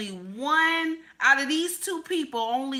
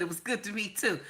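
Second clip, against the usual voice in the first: ordinary delivery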